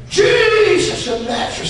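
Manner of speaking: high, drawn-out cry to Jesus; calling out. Acted. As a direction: call out slowly for someone passing by. Blind man calling out to Jesus.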